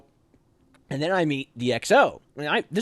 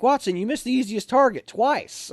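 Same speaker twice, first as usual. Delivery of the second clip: mild scolding officer; 11 semitones higher, 4 dB louder than usual. Retold or imitated. XO scolding at range.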